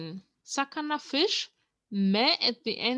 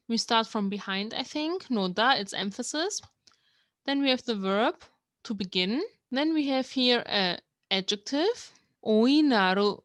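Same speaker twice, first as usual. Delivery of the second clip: ordinary talk, nothing marked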